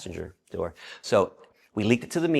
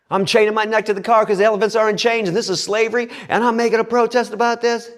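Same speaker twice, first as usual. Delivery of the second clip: high, fast, shouted declaration; militant protest; 12 semitones higher, 7 dB louder, faster than usual. Retold or imitated. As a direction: rattle it off loudly like a fired-up activist. Militant protester answering the cop.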